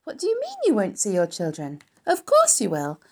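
loud, rushing reassurance; excited insistence. A narrator's reading. Wilbur cheers Charlotte about next spring, loudly excited. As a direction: build from protest into exuberant, high cheer.

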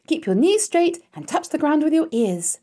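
loud, high called instruction; calling out. A narrator's reading. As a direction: call the exercise out across a yard. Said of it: "called Charlotte".

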